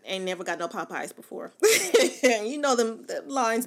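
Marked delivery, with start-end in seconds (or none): 1.6-3.1 laughing. Real, not acted. Laughing at listeners.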